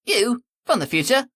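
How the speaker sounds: high, loud cockney, cheeky; cockney retort. Acted. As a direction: toss it off in cockney, cocky. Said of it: "some cockney jackass".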